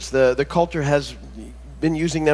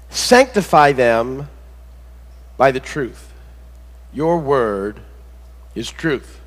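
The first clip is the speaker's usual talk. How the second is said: scripture reading; 4 dB louder than usual. Acted. Pastor reads John seventeen to congregation, solemn and measured. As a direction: read it as holy words, unhurried.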